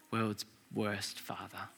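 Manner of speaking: slow, hushed self-reproach; quiet, heavy verdict; mournful whisper. Acted. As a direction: drop almost to nothing.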